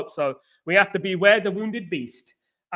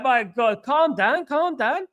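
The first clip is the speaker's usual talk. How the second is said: higher-pitched retold plea to settle down; retold calming plea; 6 semitones higher, slower than usual. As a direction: urge him to settle, pitched up. Retells own reaction to angry man.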